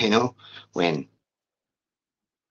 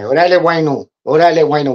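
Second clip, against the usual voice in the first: louder, higher greeting called out; calling out; 6 semitones higher, 6 dB louder, faster than usual. Retold or imitated. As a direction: hail them as they pass. Brothers calling greetings passing cells.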